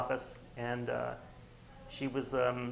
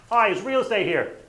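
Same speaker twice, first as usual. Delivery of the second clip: high, loud, fast salesman pitch; bright doorstep opener; imitating a real estate agent knocking; chirpy door knocker; 10 semitones higher, 8 dB louder, faster than usual. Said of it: "his door knocking script".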